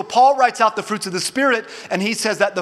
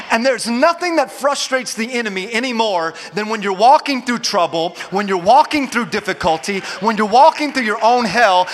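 ordinary talk, nothing marked

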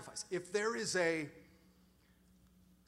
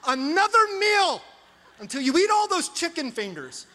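mock-stern, climbing higher and louder to a laugh; hammed-up dad rant; 6 semitones higher, 10 dB louder than usual. Dad mock-scolding his kids over uneaten chicken fingers, building to a hammed-up yell. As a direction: play the furious dad, building to a shout. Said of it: "And I'm like".

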